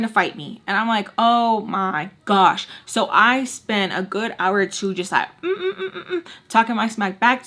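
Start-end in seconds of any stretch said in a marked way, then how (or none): 1.2-2.7 drawn-out disbelief. Retold or imitated. Reaction to the fight message. 5.4-6.3 mocking hum. Stands in for talking smack.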